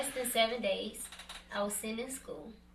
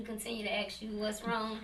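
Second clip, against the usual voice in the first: normal talking voice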